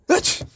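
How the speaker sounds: loud comic sneeze. Acted. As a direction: let a big sneeze rip. Sneeze from the feather.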